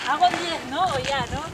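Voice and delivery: ordinary talk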